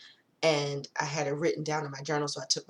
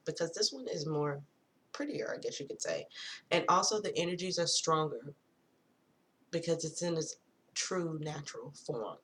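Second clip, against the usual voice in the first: usual speaking voice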